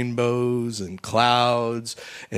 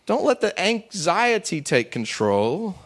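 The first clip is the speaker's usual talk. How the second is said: ordinary delivery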